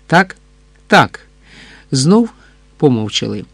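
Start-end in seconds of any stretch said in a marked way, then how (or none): none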